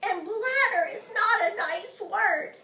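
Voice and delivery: high, loud mocking girl voice; mocking May. Junie mimicking May to the class, high and loud. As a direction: parrot May's complaint in a high whine.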